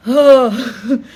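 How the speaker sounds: long, loud, high "Oh" of the impressed driver; loud mock driver "oh". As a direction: blurt "Oh" like the awestruck Uber driver. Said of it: "And he goes".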